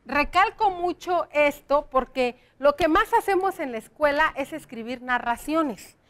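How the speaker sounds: usual speaking voice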